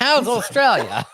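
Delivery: high, loud, drawn-out teasing quote through laughter; mock greeting. Teasing friend about mistaking the man for an Australian, high mock greeting. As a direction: call it out slowly and high, laughing. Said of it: "and you were like".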